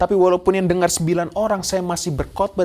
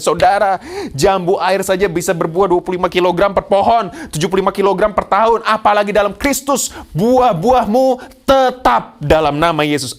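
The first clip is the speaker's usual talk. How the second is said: loud, building proclamation, slowing at the climax; rousing preaching; 4 semitones higher, 6 dB louder than usual. Acted. Preacher promising the congregation fruit in Christ, forceful loud declaration. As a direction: drive it home to the congregation.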